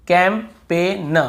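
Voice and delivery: कैंपेन slowly split as "kam-pe-n"; syllable by syllable. Shown as a demonstration. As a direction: draw out कैंपेन in parts, leaving out G. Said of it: "यहाँ पर भी जी silent है".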